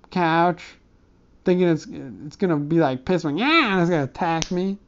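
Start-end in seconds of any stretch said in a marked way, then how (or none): 3.4-3.7 a quick high yowl of a cat, lightly voiced; cat impression. Comic telling a story, doing a light cat impression. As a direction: play the cat about to pounce, a short mock hiss-like yeah. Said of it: "That was my cat impression".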